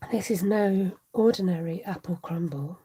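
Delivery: seduce them with each ingredient like a posh ad; M&S advert voice. Retold or imitated. Telling viewers her dinner, in the Marks and Spencer's ad voice. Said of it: "in the Marks and Spencer's voice".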